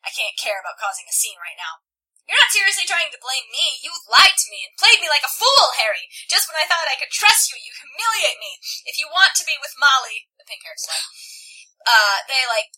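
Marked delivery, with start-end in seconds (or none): 2.3-10.3 loud, raised-pitch acted rant; heated, wounded indignation; angry accusation. A narrator's reading. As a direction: pile up the grievances forcefully. Tessa accuses Harry in a quarrel.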